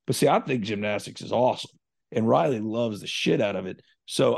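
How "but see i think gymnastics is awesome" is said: normal talking voice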